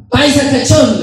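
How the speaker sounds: high, loud jeer; shouted taunt. Acted. Neighbour yelling from the upstairs window, taunting shout. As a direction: call it out like a heckler. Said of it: "le gritaba".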